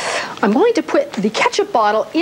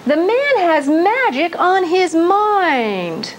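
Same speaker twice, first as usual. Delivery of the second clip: slow showman announcer line; 4 semitones higher, much slower than usual. Acted. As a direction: announce it slowly, with flair.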